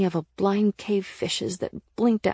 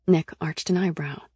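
ordinary delivery, nothing marked

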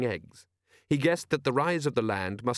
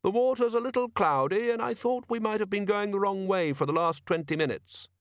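high tinny apologetic explanation; polite and pitched far up; 9 semitones higher than usual. A narrator's reading. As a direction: explain courteously in a piping, tinny voice. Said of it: "in a tinny yet polite voice".

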